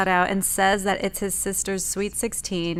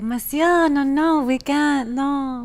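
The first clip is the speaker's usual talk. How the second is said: higher, louder mock French accent; 5 semitones higher, 3 dB louder, slower than usual. Imitating Ani refusing him, a higher mock French accent. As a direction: play a French woman saying no, higher. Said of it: "That's just the way she talked".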